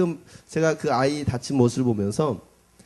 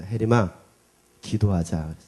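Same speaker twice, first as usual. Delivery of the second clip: gentle coaxing; 6 semitones lower than usual. Retold or imitated. Father comforting crying daughter.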